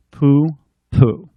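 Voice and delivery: unimpressed scoff; dismissive pooh-pooh. Acted. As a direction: brush it off like nothing. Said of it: "[name] just said".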